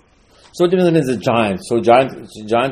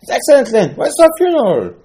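much higher, loud outraged protest; preacher arguing with the spies, pitched way up; indignant disbelief; 14 semitones higher, 4 dB louder than usual. Acted. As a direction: shoot back in shocked disbelief. Acted back-and-forth with the spies.

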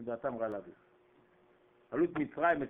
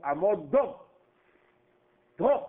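barked command; 2 semitones higher, 4 dB louder than usual. Acted. Brothers draw guns, order halt.

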